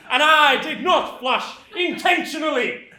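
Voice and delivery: loud, slow laughing bellow; shouting confession. Acted. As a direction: proudly boom it out.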